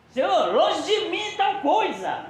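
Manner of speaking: high, slow pleading cry; Peter protesting. Retold or imitated. Peter protesting to Jesus, high and slow. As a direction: beg him to spare himself, drawn out.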